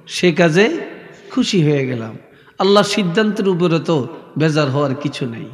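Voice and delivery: ordinary delivery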